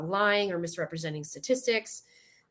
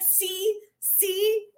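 vindicated; 12 semitones higher than usual. Retold or imitated. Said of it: "I was like".

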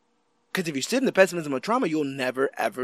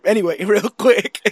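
laughing; 5 semitones higher, 7 dB louder than usual. Real, not acted. Laughing podcast intro.

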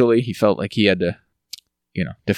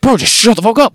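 mock angry; 10 semitones higher, 4 dB louder than usual. Retelling the quarrel.

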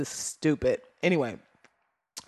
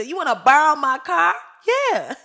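high, loud disbelief; can't believe the nerve, pitched way up; incredulous; 10 semitones higher, 8 dB louder than usual. Real, not acted. Host to the listeners about lending her car, loud incredulous.

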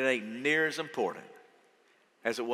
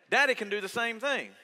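ordinary talk, nothing marked

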